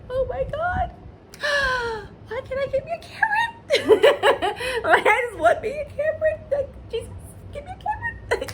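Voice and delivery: very high, teary, laughing whine; crying laugh, swooning. Real, not acted. Wishing for a Cameron, laughing through happy tears. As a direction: wail it like you're overcome with longing.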